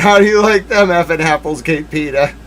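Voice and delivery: usual speaking voice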